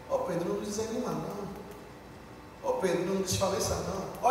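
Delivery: soft, slow and limp encouragement; low, lifeless way of cheering someone up; flat, dispirited. Shown as a demonstration. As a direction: say it like you don't care. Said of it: "Olha a diferença".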